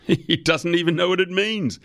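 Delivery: high-pitched amused mockery after a laugh; laugh, then say it high and incredulous. A narrator's reading. Company head mocking the boss to a junior, laughing. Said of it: "Malcolm Torrent laughed".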